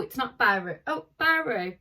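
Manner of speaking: ordinary delivery, nothing marked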